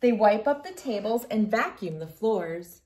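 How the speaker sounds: gentle storybook reading voice; reading to children. Acted. Reads picture book aloud.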